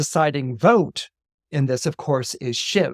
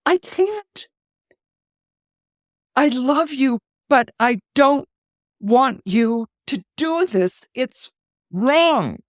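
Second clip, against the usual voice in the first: tearful refusal; 9 semitones higher, 4 dB louder, slower than usual. Retold or imitated. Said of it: "she's like".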